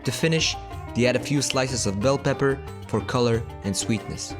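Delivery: low, soft, measured narration; documentary voice-over. Acted. Recorded food voice-over narration.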